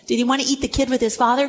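ordinary talk, nothing marked

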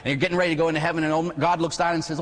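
usual speaking voice

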